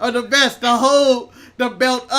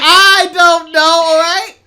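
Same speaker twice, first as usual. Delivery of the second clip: loud, higher, drawn-out shout; exasperated outburst; 5 semitones higher, 9 dB louder, much slower than usual. Real, not acted. Podcaster pressed on sandwich ingredients, exasperated shout. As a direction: burst out in defensive exasperation.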